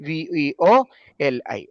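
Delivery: letter by letter, paused in small groups; spelled out. Shown as a demonstration. Teacher telling student to remember the spelling, letter by letter. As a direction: spell it out in chunks, dropping on the last. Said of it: "spelling ഓർത്തിരിക്കണം കേട്ടോ".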